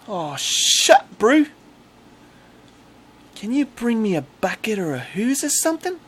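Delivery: loud, high-pitched Kiwi accent; hammed-up New Zealand bro, high and loud. Shown as a demonstration. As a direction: cry out in a put-on Kiwi voice. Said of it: "I'm doing a New Zealand accent".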